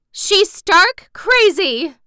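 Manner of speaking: high, loud, drawn-out outcry; crying out. A narrator's reading. Aunt reacting to the girl's tale, crying out. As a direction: exclaim it in scandalized, overblown disbelief. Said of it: "cried Aunt Em".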